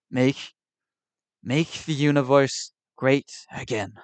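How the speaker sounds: slow, mild New York Trump impression; a hint of Trump's cadence, drawn out; lightly voiced Trump slogan. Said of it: "gotta do under the hand stuff".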